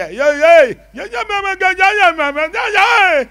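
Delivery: loud, high wordless bleating; mute straining sounds. Retold or imitated. Struck-dumb priest trying to talk, loud high bleats. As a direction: try to speak but only strain out sounds.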